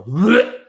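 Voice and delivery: loud, high retch of disgust; imitated gag. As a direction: blurt out a mock gag. Imitating his gag reaction.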